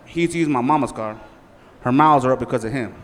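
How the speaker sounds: ordinary delivery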